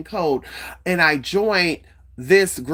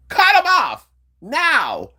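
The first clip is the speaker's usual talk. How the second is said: slow, high and loud, each word spaced out; commanding spirit voice; 8 semitones higher, 4 dB louder, much slower than usual. Retold or imitated. The spirit orders her to cut them off, slow and loud. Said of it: "the idea said".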